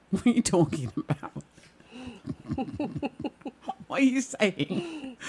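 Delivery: can't get the question out for laughing; laughing while quoting. Real, not acted. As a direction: quote it through breaking laughter. Cracking up quoting texters' confusion.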